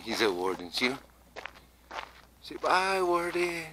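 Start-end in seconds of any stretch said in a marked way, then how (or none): 2.4-3.6 high sing-song mock farewell; teasing goodbye. Real, not acted. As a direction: tease him with a lilting bye. Teasing a passing game warden.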